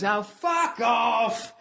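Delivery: slow, high drawn-out heckle; heckler shout. Retold or imitated. Guy in the back heckling the comic, slow mock shout. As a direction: drag it out like a rowdy heckler. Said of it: "some guy in the back just goes".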